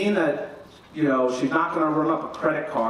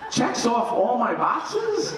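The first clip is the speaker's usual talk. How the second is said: incredulous; 7 semitones higher, much slower than usual. Retold or imitated. Incredulous repeat.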